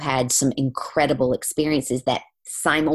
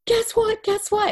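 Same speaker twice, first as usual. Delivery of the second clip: much higher, bubbling guess what; excited. Retold or imitated. Client running up in town, excitedly much higher. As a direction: burst out with news, very high. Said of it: "she was so excited".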